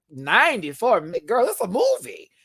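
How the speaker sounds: high-pitched, mock-incredulous exclamation; retold disbelief. Host recounting shock at the episode's length, mock disbelief. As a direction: replay your disbelief with pitch jumping up. Retelling own stunned reaction to runtime.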